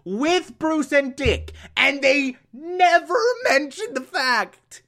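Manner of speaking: high, drawn-out incredulous protest; outraged rant. Real, not acted. Reviewer ranting that they never mention it, incredulous outrage. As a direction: stretch the words out in rising indignation.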